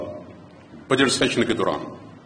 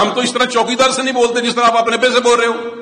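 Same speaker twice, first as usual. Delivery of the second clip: high, louder rebuke to a rude son; preacher retelling his rebuke, raised and indignant; scolding retort; 10 semitones higher, 4 dB louder than usual. Retold or imitated. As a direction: scold him for disrespecting his father. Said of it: "میں نے کہا".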